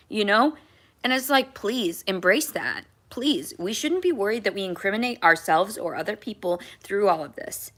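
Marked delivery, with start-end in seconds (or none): none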